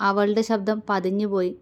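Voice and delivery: ordinary delivery, nothing marked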